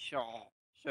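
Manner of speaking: "স" drawn out from inside the throat. Shown as a demonstration. Teacher demonstrating pinyin S, long "স" from the throat. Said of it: "মানে গলার ভিতর থেকে উচ্চারণ হবে".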